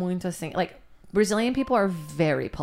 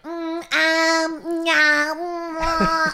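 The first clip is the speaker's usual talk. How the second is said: high, hesitant humming indecision; mock dithering friend; 6 semitones higher, much slower than usual. Mocking friends who can't decide what to order.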